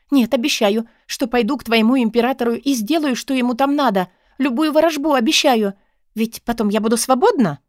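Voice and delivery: high, earnest promising; high-voiced hopeful young woman; eager Katarina. A narrator's reading.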